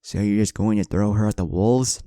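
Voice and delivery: angry question. A narrator's reading. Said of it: "Naruto angrily asked".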